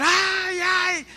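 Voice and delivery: high-pitched mock cries of pain; imitated wife's screaming. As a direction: yelp as the beaten wife in a retold story. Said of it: "la esposa empezó a gritar". (imitando gritos agudos de la esposa)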